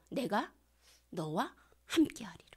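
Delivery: soft whispered divine promise. Acted. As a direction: breathe it like a tender promise. Quoting God softly. (속삭이듯 다정하게)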